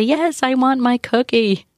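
put-on child's whine. Child whining to parent for a cookie. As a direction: play the child begging for a cookie. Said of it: "start crying and say".

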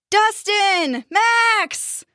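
high, drawn-out call. A narrator's reading. Heads calling up to the boys, high call. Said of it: "the heads all called out together".

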